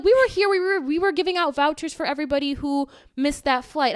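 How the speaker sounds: high, loud sing-song mockery; mocking the agents. Traveler mocking the lying airline staff, high and loud. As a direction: exaggerate their fake excuses.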